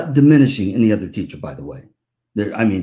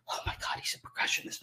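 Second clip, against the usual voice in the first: nervous whisper; 17 dB quieter, faster than usual. Retold or imitated. Nervous dad whispering to speaker.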